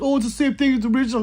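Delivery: high, fast, smug voice of a clone buyer; mocking clone buyer. Gun owner mocks clone buyers, high and fast, sneering. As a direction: sneer it in a mock-naive high voice. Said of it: "They're like".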